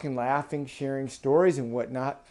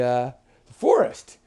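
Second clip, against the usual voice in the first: plain reading voice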